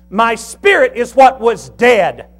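slow, high, loud pulpit cadence; building preaching. Acted. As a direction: hammer each repeated line harder. Preaching at full force.

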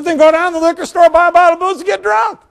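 high, loud, fast and exasperated; hammed-up insistence. Pastor ordering the widow a third time, high and loud. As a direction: rattle the order off, almost yelling.

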